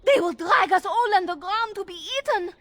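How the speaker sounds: high, breathless mock alarm; faked panic. Erlina fakes panic to lure the guards, high mock alarm. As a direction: play the frightened maid to lure them away. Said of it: "I will go distract the guards".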